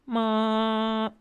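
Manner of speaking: MA long, high and unchanging. Shown as a demonstration. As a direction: hold ma like a steady hospital beep. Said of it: "sound like the hospital".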